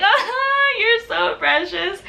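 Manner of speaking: high, slow retold fawning; gushing squeal. Fan whose hands the idol grabbed, high gushing coo. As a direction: coo it high, drawing out the words.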